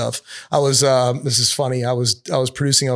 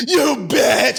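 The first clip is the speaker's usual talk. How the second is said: imitating a vulgar scream, much higher and louder; menacing scream; 10 semitones higher, 3 dB louder than usual. Auditioning actor screams the line at the producer, vulgar menacing scream. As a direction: hurl the insult like a menacing scream. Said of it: "screams this, like, vulgar, like, menacing scream".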